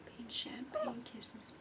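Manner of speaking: slow, soft breathy whisper; sultry whisper. Acted. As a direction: purr it as a soft kiss-off. Signature sign-off whisper.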